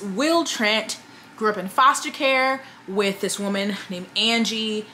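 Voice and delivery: normal talking voice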